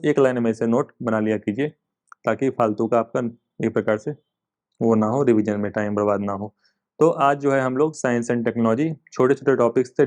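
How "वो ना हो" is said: ordinary talk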